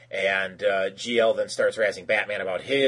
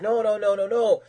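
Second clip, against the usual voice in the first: higher, louder mock protest; mock-panicked protesting; retold flustered denial; 10 semitones higher, 5 dB louder than usual. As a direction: rattle off a string of denials, imitating a cornered husband. Acted husband protesting accusation.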